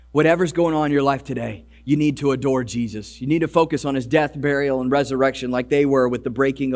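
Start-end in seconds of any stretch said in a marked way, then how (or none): none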